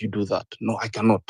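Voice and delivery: ordinary delivery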